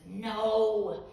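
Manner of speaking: groaning no, a loud burst then a laughing rest; weary teacher fed up with Icarus; exasperated teacher. Retold or imitated. Teacher groaning inwardly at a student poem, loud exasperated no. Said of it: "teacher bangs his head against the wall".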